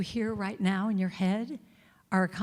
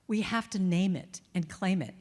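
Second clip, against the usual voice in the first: normal talking voice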